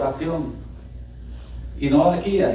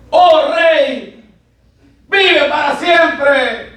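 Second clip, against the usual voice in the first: high, loud, drawn-out shout; ringing acclamation; calling out; 12 semitones higher, 7 dB louder, much slower than usual. Acted. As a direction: shout up from the pit to the king. Acts Daniel answering the king.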